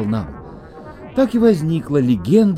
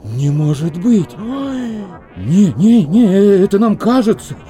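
panicked denial; 6 semitones higher, 4 dB louder than usual. Acted.

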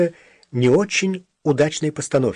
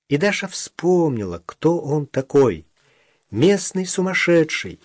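ordinary delivery, nothing marked

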